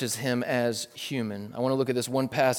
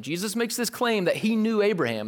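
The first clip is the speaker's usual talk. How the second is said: usual speaking voice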